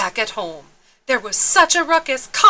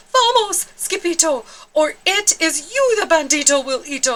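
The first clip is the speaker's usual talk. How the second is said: frightened cry; 6 semitones higher than usual. Acted. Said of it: "they cried".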